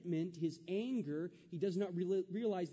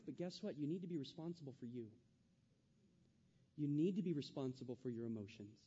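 normal talking voice

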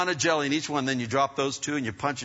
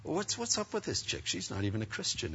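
hushed, higher-pitched private complaint; inner grumble to God; 5 semitones higher, 11 dB quieter than usual. Retold or imitated. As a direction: complain under your breath in silent prayer. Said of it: "I'm thinking".

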